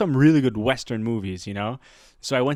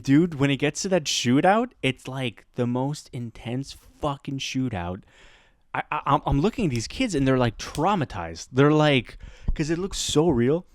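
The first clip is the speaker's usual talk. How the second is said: usual speaking voice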